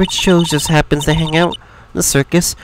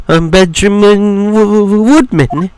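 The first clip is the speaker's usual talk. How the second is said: jittery giggle, then a stuck, stretched surname; anxious halting introduction after a tittering laugh; nervous stammerer; 4 semitones higher, slower than usual. Acted. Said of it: "This guy is so nervous".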